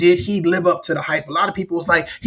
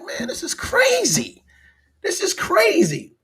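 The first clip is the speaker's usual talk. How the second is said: very high, slow squeal of disbelief; high-pitched disbelief; 12 semitones higher, slower than usual. Acted. Viewer reacting to the fight, incredulous. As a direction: stretch it out in an incredulous falsetto.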